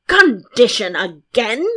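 loud, drawn-out royal roar. A narrator's reading. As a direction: bellow the question in outrage. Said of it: "roared the king".

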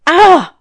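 loud, high yelp of pain; startled cry. A narrator's reading. Devil woken by a pulled hair, crying out. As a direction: cry out sharply as if yanked. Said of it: "cried the devil".

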